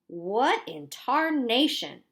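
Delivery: loud, slow astonished outburst; exclaimed. A narrator's reading. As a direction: drag it out in shocked disbelief. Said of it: "the tall man exclaimed".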